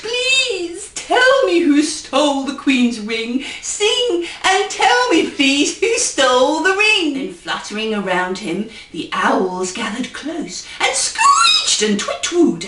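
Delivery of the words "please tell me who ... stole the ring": begging king. Acted. Lonely king begs the owls in a pleading voice. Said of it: "to them he begged".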